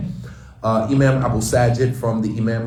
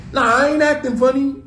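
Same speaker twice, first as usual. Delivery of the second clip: high, squeaky voice of someone caving in; mock denial; 12 semitones higher than usual. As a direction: play the person who gives in, pitched way up. Mocking the conforming reply.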